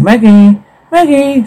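high, drawn call of a name. Acted. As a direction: call the child through the door, pitched high. Calling child behind a door.

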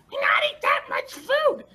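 very high, yelling hungry goblin; high goblin screech demanding to eat. Acted. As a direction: squeal it like a greedy little goblin. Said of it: "And they're like".

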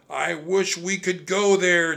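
high, loud urgent wish; shouting. A narrator's reading. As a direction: shout the wish to get home. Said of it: "Jack shouted".

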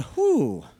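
high breath of mock relief; relieved whew. Preacher acts out reaching the dwelling place, relieved whew. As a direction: let out an acted sigh of relief. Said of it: "And you go".

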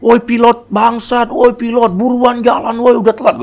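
high, loud mock yelling; high rowdy shouting crowd voice; passengers yelling. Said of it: "Penumpang yang lain makin teriak-teriak".

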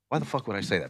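calm retold dad. Imitating dad shaming him before friends, quiet and calm. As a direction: say it softly, without any push. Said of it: "he said it so calm".

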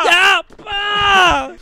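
sudden high outraged cry. Real, not acted. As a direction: yell out in mock despair. Outburst at tiny donation.

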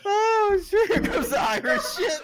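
slowed words through laughter; high laughing remark; laughing while talking. Real, not acted. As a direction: squeeze it out while cracking up. Laughing about aftertaste.

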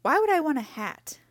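high, loud scornful question; sneering. A narrator's reading. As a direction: sneer in indignant disbelief. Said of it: "sneered Fox".

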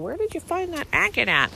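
a playful hint of the curious husband; imitating husband. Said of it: "my husband will go".

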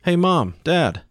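put-on high-pitched boy calling for his parents; child's searching call. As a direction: call for mom and dad through the house.

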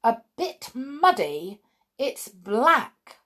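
stern mother's disbelieving retort; grimly indignant. A narrator's reading. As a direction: throw the words back in disapproval. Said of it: "Her mother looked at it grimly".

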